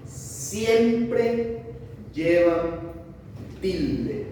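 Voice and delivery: siempre, llevan, tilde each said as a separate emphatic word with pauses; teacher hammering the rule word by word; emphatic teaching stress. Shown as a demonstration. Teacher to class, spelling out the rule word by word. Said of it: "O sea que no hay una excepción".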